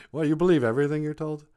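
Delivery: laugh, then rub it in fast; mocking frog. Frog jeers at the gullible princess, mocking. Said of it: "and then the frog goes".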